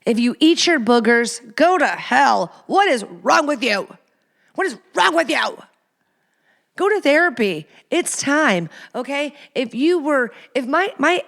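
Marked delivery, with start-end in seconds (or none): 2.7-5.6 outraged repeat. Real, not acted. Repeated, second rising in outrage.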